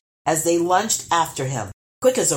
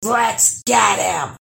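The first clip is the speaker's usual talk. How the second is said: urged; 4 semitones higher, 4 dB louder, much slower than usual. A narrator's reading. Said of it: "urged the first troll".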